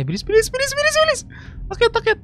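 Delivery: high, rushed urging; urgent hurry. Real, not acted. Gamer urging the on-screen character to hurry. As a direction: hurry the character along, pitch shooting up.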